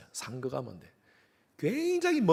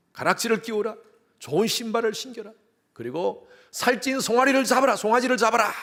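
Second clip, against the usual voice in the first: raised, commanding father voice, higher; preacher voicing the father ordering servants, higher and commanding; father's command; 8 semitones higher, 3 dB louder than usual.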